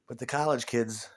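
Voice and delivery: usual speaking voice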